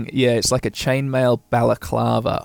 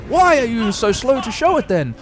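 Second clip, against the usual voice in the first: bossy gnome; 9 semitones higher than usual. Acted.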